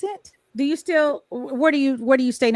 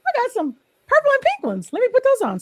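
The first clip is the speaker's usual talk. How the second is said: higher, brighter and a bit louder, pleased with the find; delighted discovery; 13 semitones higher, 3 dB louder than usual. Retold or imitated. Host recalling finding the purple and pink reading glasses, mock delighted. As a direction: act out happily spotting the colors. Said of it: "And I was like".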